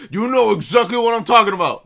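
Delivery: loud, high-pitched confrontational shout; angry dad yelling. Retold or imitated. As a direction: imitate an irate father storming over. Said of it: "like, a yelling match at each other".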